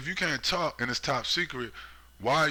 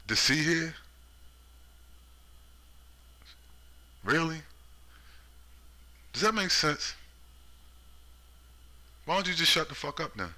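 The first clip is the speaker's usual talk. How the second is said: incredulous; 7 semitones higher than usual. Real, not acted. Mocking disbelief at the alert.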